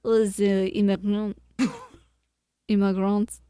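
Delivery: immigrants in a light mock French accent. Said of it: "So you need to say with a French accent".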